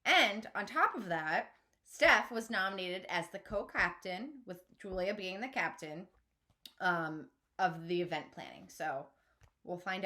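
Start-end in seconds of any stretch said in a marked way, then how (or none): none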